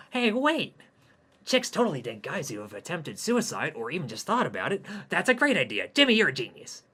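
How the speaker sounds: loud, sudden burst of joy; excited. Acted. Hayden shouts his suicide idea with glee. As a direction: leap up with a bright idea.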